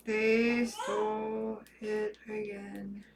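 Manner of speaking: soft and slow, stunned; quiet dismay. Real, not acted. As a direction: say it slowly under your breath in disbelief. Hacker steals account again.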